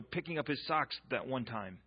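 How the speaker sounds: ordinary delivery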